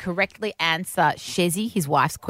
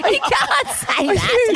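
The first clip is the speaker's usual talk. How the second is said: slow, high, laughing protest; laughing scold; 4 dB louder, much slower than usual. Real, not acted. Reacting laughing to swearing.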